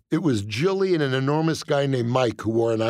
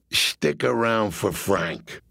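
slow, lower, menacing quote; mobster quoting the line; 4 semitones lower, slower than usual. Retold or imitated. Mobster slowly quoting the comic's line back at him, menacing. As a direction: hold each word like a threat. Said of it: "What did you mean when you said".

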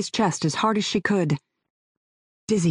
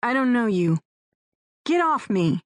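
higher, tense and defiant; defiant young woman; 4 semitones higher than usual. Acted. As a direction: push him away with your voice. Heroine pinned, refusing him.

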